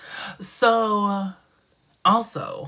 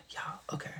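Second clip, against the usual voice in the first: whisper; 16 dB quieter than usual. Real, not acted. Whispering a secret to viewers.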